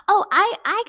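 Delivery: high, loud, stumbling start; flustered stammer. Retold or imitated. Dad answering grandma about getting home, flustered. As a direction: imitate someone caught out and scrambling. Dad caught out, makes excuses.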